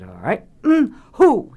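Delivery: high, loud comic "mm, who" sob; trying the mock sob. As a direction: copy the director's fake cry. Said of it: "I said".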